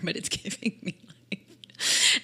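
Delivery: slow, voiceless laughing wheeze. Real, not acted. Storyteller admitting she drains her stylist, laughing.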